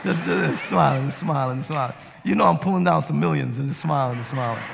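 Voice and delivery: chuckling through a mock grin; smiling and laughing. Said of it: "I'm just like this".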